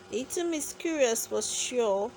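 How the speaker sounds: usual speaking voice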